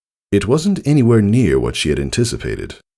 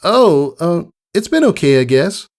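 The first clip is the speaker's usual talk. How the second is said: high, louder, caught-off-guard filly; filly snapping out of a daydream; startled Scootaloo; 13 semitones higher, 4 dB louder than usual. A narrator's reading. Voices Scootaloo.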